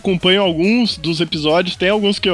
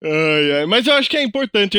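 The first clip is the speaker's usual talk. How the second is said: ordinary delivery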